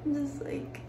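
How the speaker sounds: soft, halting, close to real tears; choked up, pausing to keep it together; trying not to cry. Real, not acted. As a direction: hold back tears, voice small and quiet. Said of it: "I'm trying not to cry".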